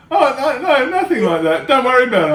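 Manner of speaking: high, loud breezy reassurance; imitating wife brushing it off. Husband mimicking wife's breezy dismissal, high and airy. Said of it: "she's like".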